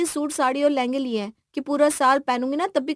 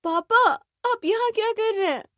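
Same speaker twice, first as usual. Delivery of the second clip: high, tearful questioning; emotional; 12 semitones higher, 4 dB louder than usual. Acted. Bride finds her hiding father, high and emotional. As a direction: call out to him, voice raised and welling up.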